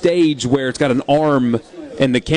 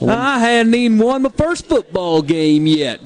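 slow, higher-pitched fake Southern drawl; mock Southern oration, vowels stretched; Foghorn Leghorn; 8 semitones higher, slower than usual. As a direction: imitate a coach laying on a phony Southern accent. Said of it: "turned into Foghorn Leghorn".

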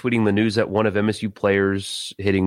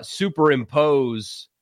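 ordinary talk, nothing marked

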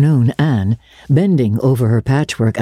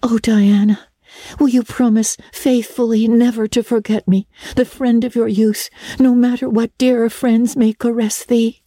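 put-on higher, girlish, earnest pleading; tearful plea; 8 semitones higher than usual. A narrator's reading. Anne begs her bosom friend to remember her, tearful plea. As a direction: plead melodramatically as a tearful girl. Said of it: "said Anne tearfully".

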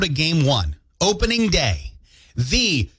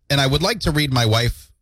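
normal talking voice

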